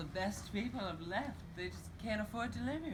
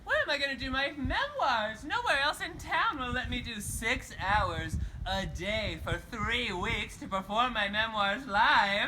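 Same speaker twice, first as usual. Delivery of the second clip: laughing rant; 7 semitones higher, 10 dB louder, slower than usual. Acted. Laughing loud rant about memoirs.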